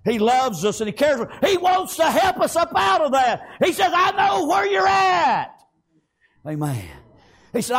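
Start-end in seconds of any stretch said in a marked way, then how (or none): 1.5-5.6 high, ringing sermon cry; fervent preaching. Acted. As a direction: lift it high and preach with full force. Preaches at full force.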